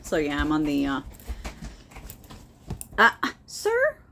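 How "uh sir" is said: much higher and louder mock indignation; mock offended. Real, not acted. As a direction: scold the dog in fake outrage. Dog interrupts, mock scolding.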